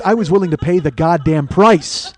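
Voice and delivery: building loud emphasis up to PRICE; loud triumphant boast. Real, not acted. Boasting to listeners about the pain, loud triumphant emphasis.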